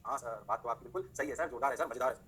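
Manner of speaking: low, softened student praising the teacher; student agreeing. Acted. Students approving the teacher's explanation, low and subdued. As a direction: play the approving student, pitched well down.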